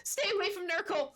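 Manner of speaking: high, frantic gnome shrieking in terror; panicked gnome. Acted. DM voices terrified gnome again.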